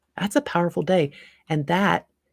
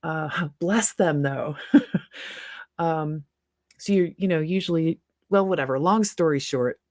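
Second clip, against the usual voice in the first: ordinary delivery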